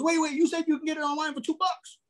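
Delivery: flea-market seller panicking over the price, mock eager. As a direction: imitate the flustered flea-market guy. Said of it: "So the guy goes".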